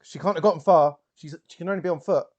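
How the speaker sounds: urgent persuading. Acted. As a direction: urge the searchers on. Character urges searchers on.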